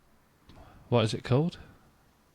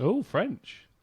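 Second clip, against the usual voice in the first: mock surprise; 9 semitones higher than usual. Mocking surprise at French.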